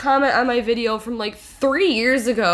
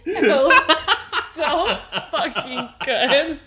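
high, slow, laugh-filled delight; 4 semitones higher, slower than usual. Real, not acted. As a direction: wheeze through the giggles. Laughing about the video.

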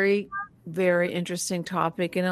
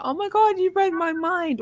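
high, delighted exclamation; excited; 11 semitones higher than usual. Retold or imitated. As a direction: squeal it out, pleasantly surprised. Acted quote to the dog.

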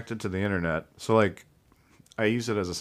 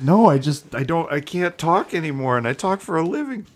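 louder, higher, defensive; mock protest; 7 semitones higher, 7 dB louder than usual. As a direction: protest loudly that you can't talk. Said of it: "It's like".

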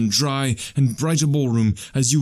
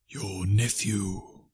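very slow, softer spirit voice; solemn ghost; 3 semitones lower, 7 dB quieter, much slower than usual. Acted. Ghost reminds Scrooge of his nephew, slow and hushed. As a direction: draw the words out softly as a ghost.

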